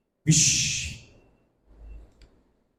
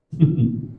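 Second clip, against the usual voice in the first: short skeptical chuckle; demo doubting laugh; 4 semitones lower than usual. Shown as a demonstration. Preacher showing the church how doubters laugh, skeptical chuckle. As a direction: show the laugh that means you don't believe. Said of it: "quando você faz assim, ó".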